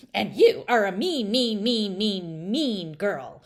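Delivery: loud scolding scream with repeated mean; screamed back. A narrator's reading. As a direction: hammer every mean. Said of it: "the frog screamed right back".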